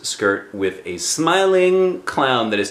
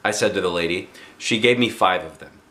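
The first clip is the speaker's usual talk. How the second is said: ordinary delivery, nothing marked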